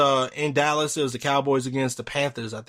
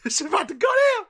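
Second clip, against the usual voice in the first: laughing shout; faster than usual. Real, not acted. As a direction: cackle it out, thrilled at the drama. Laughs as fight nears.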